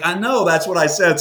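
lightly played exasperation; mock indignant retort. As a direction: throw it back at the insurance lady. Retorting to the voicemail.

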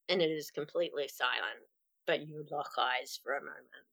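soft, low storytelling; hushed narration. A narrator's reading. Narrating eerie scene.

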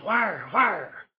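hushed urgent question; whispering. Retold or imitated. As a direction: ask it under your breath. Hunter whispers back.

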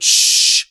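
sharp, loud hushing "shh"; loud shush. Acted. Townspeople at their windows hushing the fixer, loud shush. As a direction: shush someone forcefully. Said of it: "people opened their windows and cried out".

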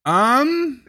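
high, drawn-out puzzled um; stumped um. Real, not acted. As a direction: stall on it, caught without an answer. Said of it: "you made me do a good um".

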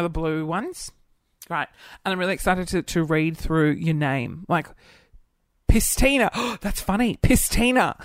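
normal talking voice

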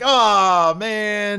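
high, stretched-out imitated exclamation; drawn-out mock groan. Host reacting to the champion's outburst, drawn-out mock groan. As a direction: draw it out as a hammed-up reaction.

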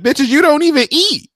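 high-pitched indignant comeback; exasperated mock outburst. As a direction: squeal it in mock outrage at a nagging partner. Acted quarrel with the doll.

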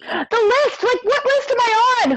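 very high-pitched mock bewilderment; incredulous outburst. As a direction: squeal it in exaggerated disbelief. Acts out disbelief about the list.

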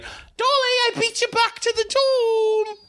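very high-pitched excited schoolgirl; Harriet. Acted. Harriet greets Dolly back at the dorm, very high and sing-out. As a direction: call it out high, stretching the last word. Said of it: "Harriet's gonna be like".

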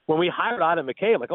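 ordinary talk

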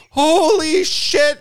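hammed-up disbelief, stretched long; Todd freaking out. Friend hearing about new teeth, shocked high yelp. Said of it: "He's like".

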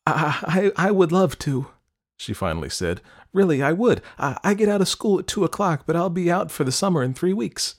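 high, stammering eager acceptance; flustered, keen teenage girl; nervous eagerness. A narrator's reading. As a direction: rush to say yes, pitched high. Nervous girl accepting crush's request.

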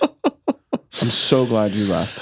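low, very slow delivery; slow, drawn out. Acted. Repeating relief that he left, slow and low. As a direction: stretch each word out, pitched low.

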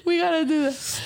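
a high, delighted ooh; excited ooh. Retold or imitated. Reacting in the back of the room, a high delighted ooh. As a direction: squeal it from the back. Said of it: "and I'm in the back like".

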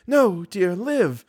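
high, gentle woman's voice; grave, changed voice. Acted. Woman answering her despairing lover, grave and changed. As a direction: say it tenderly and gravely, pitched high. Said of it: "grave and changed voice".

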